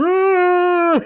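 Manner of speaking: very high, loud shout lunging at the manager; mock roar. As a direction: roar as if grabbing someone. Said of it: "And he goes".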